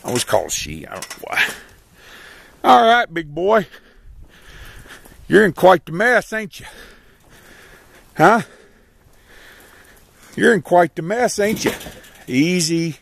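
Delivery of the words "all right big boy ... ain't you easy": higher, gentle coaxing to a downed horse; high sing-song reassurance to an animal; soothing animal talk. Real, not acted.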